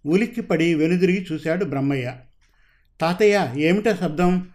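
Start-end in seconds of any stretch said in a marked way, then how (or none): none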